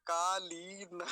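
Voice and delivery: कालीन stretched on lee, ending in a released na; long ee. Shown as a demonstration. Teacher repeating the correct word for students, long ee. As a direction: draw out कालीन as kaa-leee-na. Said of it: "लकार पर दीर्घ ईकार है".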